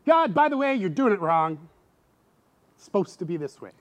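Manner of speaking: lightly voiced scolding; half-hearted finger-wag at God; mock complaining to God. Pastor admitting he scolds God, mock complaint. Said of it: "I've said that plenty of times".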